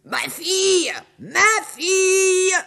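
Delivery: high, slow ogress call. Acted. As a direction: call into the empty house. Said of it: "Elle appelle". (l'ogresse appelle sa fille, aiguë)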